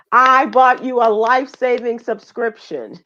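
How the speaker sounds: high, loud playful proclamation; mock gift announcement. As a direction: announce it like a grand present, in jest. Playfully acts out giving the gift.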